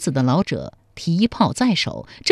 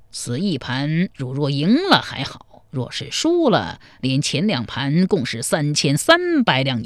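put-on old player's measured, doubtful warning; cautioning old man; 4 semitones lower than usual. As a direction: voice an old man doubting his opponent can pay. Narrator voices the old chess player. (老者腔，不紧不慢地提醒)

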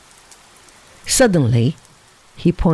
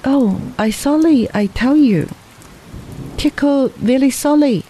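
higher-pitched, earnest apology; apologetic; 8 semitones higher than usual. A narrator's reading. Boy telling his companion he is very sorry, apologetic. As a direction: plead sorry with the pitch lifted.

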